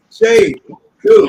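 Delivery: calls the name out high and loud; a big shout-out welcome; excited greeting. Real, not acted. Host greets arriving guest.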